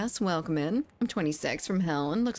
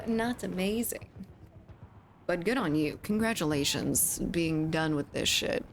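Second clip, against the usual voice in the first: usual speaking voice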